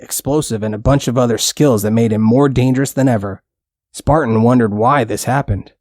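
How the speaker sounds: plain reading voice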